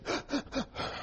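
put-on whispered toddler's hitching sob gasp; imitated sob gasp. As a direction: gasp like a child still catching breath after crying. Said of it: "And he still got that".

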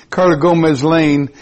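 normal talking voice

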